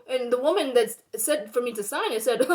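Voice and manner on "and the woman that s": normal talking voice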